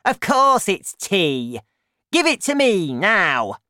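loud, high, demanding granny; loud, insistent old-woman voice; Grandma shouting. A narrator's reading. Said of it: "Grandma shouted".

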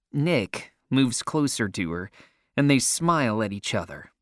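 plain reading voice

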